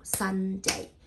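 "Sunday" as a long SUN, then DAY; two drawn-out syllables. Shown as a demonstration. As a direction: stretch the N in "Sunday" before the second syllable. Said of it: "two syllable".

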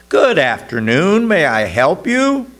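high, loud shopkeeper's hello; bouncy, projected welcome; cheerful greeting. A narrator's reading. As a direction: greet a customer brightly, voice lifted. Shop clerk greets a customer.

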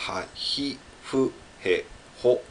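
ha, hi, fu, he, ho with an audible H; sounded H. Shown as a demonstration. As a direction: sound out "ha, hi, fu, he, ho" syllable by syllable. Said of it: "se prononce".

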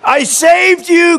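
high, slow, stretched-out cry; hammed-up wounded woman. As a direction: cry out in betrayed disbelief. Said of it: "cried the woman".

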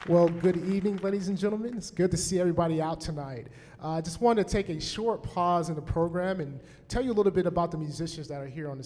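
loud, projected address to the audience; podium speech. Real, not acted. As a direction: speak out to the whole hall. Bandleader addresses the concert audience.

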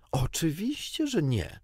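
high-pitched indignant reply. A narrator's reading. Wendy answering whether John may take father's chair, indignant. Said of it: "Wendy była oburzona".

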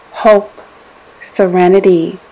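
ordinary talk, nothing marked

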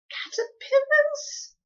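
very high, slow shriek; horrified. Acted. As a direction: shriek in disgust. Homily horrified.

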